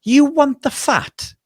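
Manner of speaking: high, slow, disbelieving woman's voice; incredulous lady. Retold or imitated. Imitating the customer at the butcher, high and incredulous. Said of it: "this lady looked at me as if I was absolutely mental".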